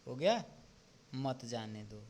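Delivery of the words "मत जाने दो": मत जाने दो kept together, lower and softer; separate phrase after pause. Shown as a demonstration. Teacher showing a clear prohibition, मत जाने दो said as one unit. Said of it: "इसमें स्पष्टता आई".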